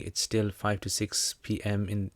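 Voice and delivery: normal talking voice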